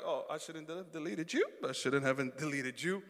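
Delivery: low, soft mock remorse; sheepish regret. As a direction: mumble it low, realizing your mistake. Sheepish reaction to the guests.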